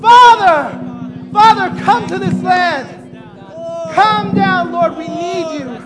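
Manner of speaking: loud, high impassioned shouted prayer; fervent shouted prayer, at times sung; crying out in prayer. Real, not acted. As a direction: pour your whole heart out, crying out to God. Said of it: "shouting biblically to God".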